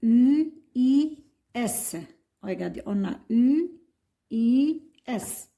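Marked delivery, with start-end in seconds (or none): 0.0-1.9 spelled out slowly, letter by letter; spelling letters aloud. Shown as a demonstration. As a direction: dictate U I S slowly to the class. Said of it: "تكتب S".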